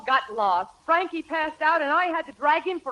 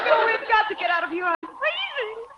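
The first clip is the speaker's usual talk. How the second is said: freezing distress; 7 semitones higher than usual. Acted. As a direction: wail it, desperate to get out of the cold. Wife complains of the cold.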